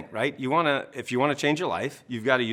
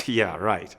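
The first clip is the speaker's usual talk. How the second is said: sarcastic scoff; 3 semitones lower than usual. Retold or imitated. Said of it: "your brain goes".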